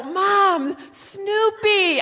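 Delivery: loud, high re-enacted yell; exasperated shout. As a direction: holler the word at a deaf parent. Daughter shouts at hard-of-hearing mom.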